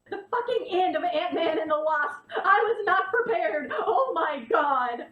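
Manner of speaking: loud, high wail of shock; distraught outburst. Real, not acted. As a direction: cry out in overwhelmed fan despair. Outburst over shocking film ending.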